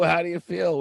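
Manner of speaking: high, loud scornful repeat; mock shouted quote. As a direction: bark the question back in scorn. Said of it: "she says".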